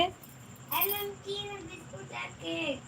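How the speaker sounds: put-on high, soft little girl voice, slow; child voice. Mother voicing her daughter wanting biscuits, soft high child voice. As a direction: whine for salty biscuits like a toddler.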